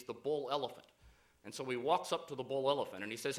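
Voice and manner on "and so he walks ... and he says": ordinary talk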